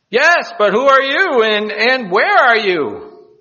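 high-pitched plea, slowing at the end; urgent calling out. Acted. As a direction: call up to an unseen rescuer. Cliff hanger questions the voice.

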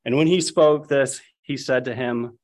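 ordinary talk

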